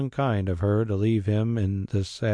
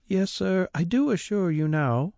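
much higher woman's voice, eager; a maid assuring her master; servant Mary; 10 semitones higher than usual. A narrator's reading. Mary assures the squire, high eager voice.

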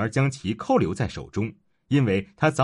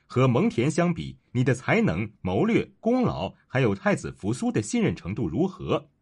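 plain reading voice

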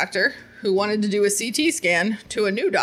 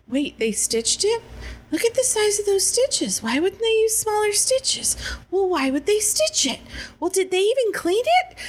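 high, fussy doctor chatter; mock the doctors fussing over stitches; imitating doctors talking over the patient; gossiping doctors; 8 semitones higher than usual.

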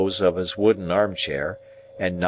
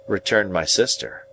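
usual speaking voice

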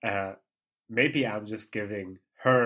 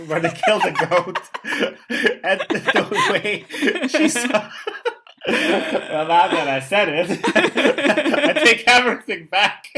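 real laughter breaking through, higher and louder; words squeezed out through giggles; can't get through it for laughing; 8 semitones higher, 10 dB louder than usual. Real, not acted. Cracking up at own memory.

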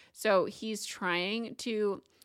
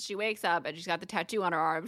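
ordinary delivery

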